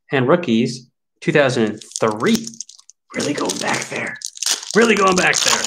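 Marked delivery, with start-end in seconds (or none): 3.1-4.2 hushed aside. Pretend. Card opener marvels at an old pack, hushed. Repeats a line in contrasting voice. 4.8-5.6 higher, fully voiced exclamation; raised-voice repeat. Card opener repeats it for viewers, voice raised.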